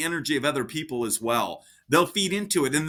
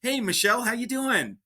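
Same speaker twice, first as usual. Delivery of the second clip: pitched up, lively phone hello; bright energetic greeting; 7 semitones higher than usual. Shown as a demonstration. Agent answering a client's call, upbeat and lively. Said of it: "And then I actually answer the phone".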